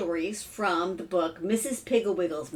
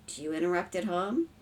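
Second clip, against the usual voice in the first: plain reading voice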